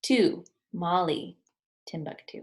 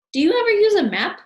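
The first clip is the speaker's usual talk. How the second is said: much higher, louder and fast; anxious Wallace; 14 semitones higher, 11 dB louder, faster than usual. Acted. Wallace pressing Albert, high and hurried. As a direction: blurt it out nervously.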